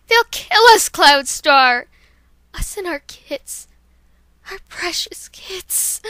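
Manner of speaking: loud, high plea fading to a slow whisper; panicked cry that breaks to a whisper; Dawn Flash terrified. Acted. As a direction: cry out, then whisper over the kits. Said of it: "here's Dawn Flash".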